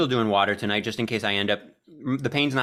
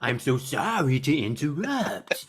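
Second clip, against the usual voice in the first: slow drawn-out goofy voice; Adam Sandler impression; 2 semitones higher, slower than usual. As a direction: quote it like a silly movie line. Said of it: "it's in Billy Madison ... And he's like".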